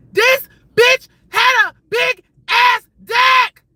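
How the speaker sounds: slow, high shout with a break after every word; loud, drawn-out, scandalized staccato. Real, not acted. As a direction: punch each word separately at full volume. Repeats it for shocked emphasis.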